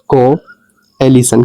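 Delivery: Coalition stretched out very slowly; slow demo pronunciation. Shown as a demonstration. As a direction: spell out coalition slowly for learners. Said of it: "ऐसे इसको पढ़ेंगे". (Coalition बहुत धीरे, साफ़ उच्चारण)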